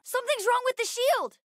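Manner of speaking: high-pitched, urgent warning; alarmed battle callout. Acted. Child warning the crew about the shield, alarmed.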